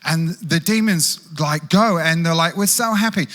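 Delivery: high, loud, gushing with joy; giddy disciples, loud and high; excited disciples. Retold or imitated. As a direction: play the thrilled disciples bragging. Pastor voicing excited disciples.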